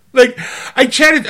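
ordinary delivery

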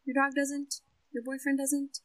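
soft, hushed and reassuring; gentle therapist. Retold or imitated. Therapist gently reassuring an anxious client, soft and hushed. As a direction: quietly talk a client down from panic. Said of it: "she just very gently goes".

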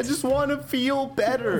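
put-on high, pleading teenage voice; whiny teen plea. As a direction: beg like a kid on the verge of tears. Son character breaking down.

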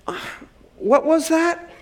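high-pitched hard-of-hearing query; feigned hearing loss. Shown as a demonstration. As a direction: pretend you didn't catch it. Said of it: "feign a little bit like you're losing your hearing".

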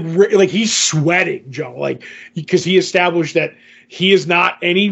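usual speaking voice

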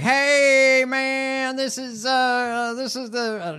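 high hammed-up trucker; loud high mock trucker on CB. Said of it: "it was like".

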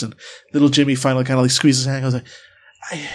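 ordinary delivery

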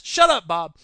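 loud shut-up; telling Bob off. Acted. Silencing Bob again.

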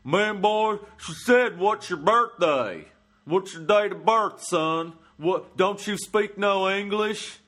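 loud, high, angry Southern hillbilly yell. Retold or imitated. Man in line yelling at comic, angry hillbilly accent. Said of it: "this hillbilly's getting mad at me".

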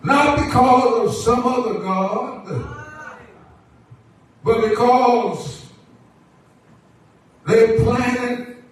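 projected sermon cadence in short, held phrases; preaching. Acted. Preacher assures the church that the Lord always provides, preaching. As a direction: stretch key words, then pause for the congregation.

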